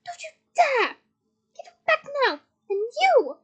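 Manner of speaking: high, loud, furious orders; screeched threat. A narrator's reading. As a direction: screech and turn on the next target. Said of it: "she screeched".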